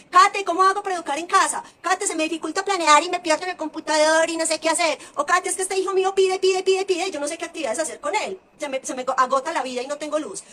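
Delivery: mock desperate parent begging for advice; pleading parent. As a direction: plead like an overwhelmed homeschooling mom. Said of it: "familias que dicen".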